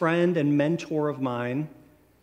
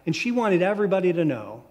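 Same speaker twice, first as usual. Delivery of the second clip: usual speaking voice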